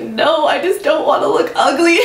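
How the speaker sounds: high, laughing, near tears; embarrassed laughing whine; laughing half-cry. Retold or imitated. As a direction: laugh it out while almost crying. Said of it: "Which is so dumb".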